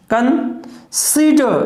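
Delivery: slow, stretch consider and lift the SID; stress on SID. Shown as a demonstration. Teacher demonstrating stress in consider, very slowly. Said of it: "कन जो है वो क्या रहेगा? Unstressed".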